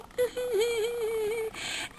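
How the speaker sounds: high breaking laugh in character. Real, not acted. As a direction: laugh through the voice. Laughing mid impression.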